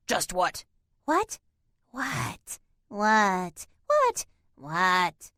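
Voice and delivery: wildly jumping eerie voices; babbling cat voices. A narrator's reading. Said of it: "They fell to babbling again".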